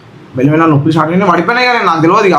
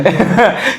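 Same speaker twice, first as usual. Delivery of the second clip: shy laugh; 4 semitones higher than usual. Real, not acted. Husband too shy to say love you, embarrassed giggle. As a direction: laugh it off bashfully. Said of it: "నాకు సిగ్గు".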